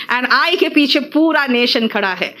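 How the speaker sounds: usual speaking voice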